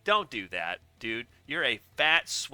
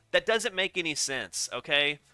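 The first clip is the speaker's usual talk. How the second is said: rising, louder indignant rant; getting mad; 7 semitones higher, 8 dB louder than usual. Real, not acted. Ranting at the racetrack owner, louder and angrier. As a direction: build to a loud, exasperated rant. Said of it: "I'm a little mad now".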